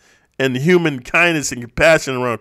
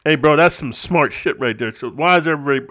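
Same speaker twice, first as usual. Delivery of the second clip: ordinary talk, nothing marked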